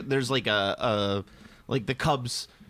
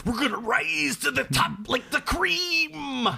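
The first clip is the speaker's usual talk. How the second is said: much higher, slow shouted promo; wrestler promo voice; 11 semitones higher, slower than usual. Retold or imitated. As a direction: yell it like a hammy wrestler.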